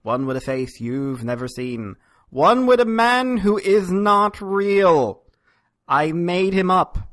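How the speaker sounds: slow, weighty announcer build-up; slow dramatic trailer voice; dramatic intro. Acted. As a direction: tease the mystery guest like a trailer.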